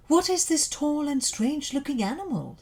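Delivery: high, wondering lion voice; a lion puzzled by a strange beast; curious lion. A narrator's reading. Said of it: "said Lion".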